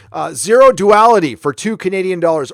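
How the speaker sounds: usual speaking voice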